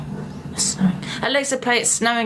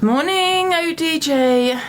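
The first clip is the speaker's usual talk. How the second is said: cheery greeting; 9 semitones higher, slower than usual. Real, not acted. Greets a viewer on the live.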